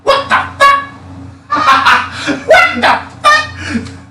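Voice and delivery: very high, squawky bird voice breaking into laughter; baffled bird. Retold or imitated. Friend imitates the bird seeing the man vanish, high squawk. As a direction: squeak it out like a stunned bird. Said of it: "and the bird's just".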